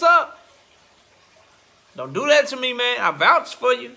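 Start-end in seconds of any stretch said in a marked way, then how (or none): none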